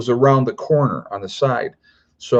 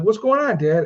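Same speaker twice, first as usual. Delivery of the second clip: much higher, questioning voice; imitated daughter; 10 semitones higher than usual. Daughter in the car asking dad what's happening, imitated. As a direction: lightly mimic a daughter's puzzled call.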